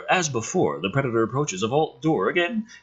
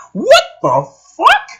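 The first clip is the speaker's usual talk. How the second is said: high, loud, stretched disbelief; shocked outburst; 11 dB louder, slower than usual. Real, not acted. Reader reacting to a twist, shocked high shout. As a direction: blurt it out in disbelief. Reading a shocking script reveal.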